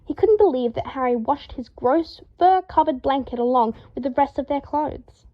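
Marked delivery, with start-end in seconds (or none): none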